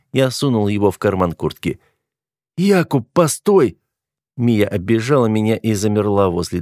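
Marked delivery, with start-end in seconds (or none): 2.6-3.8 high, pleading female character voice; urgent call. A narrator's reading. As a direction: call out high to make him wait. Heroine calls hero to stop. (высокий просящий оклик)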